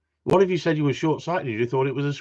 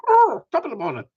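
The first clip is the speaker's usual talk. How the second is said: higher, louder leprechaun Irish greeting; leprechaun Irish accent; 9 semitones higher, 5 dB louder than usual. Acted. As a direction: put on a jolly Irish leprechaun voice. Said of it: "with a leprechaun".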